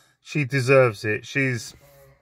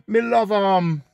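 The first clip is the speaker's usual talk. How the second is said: put-on Jamaican accent; 4 semitones higher, slower than usual. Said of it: "He goes".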